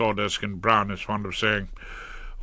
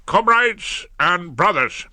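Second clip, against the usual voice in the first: strike committee address; 6 semitones higher than usual. A narrator's reading. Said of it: "as though he were addressing the strike committee".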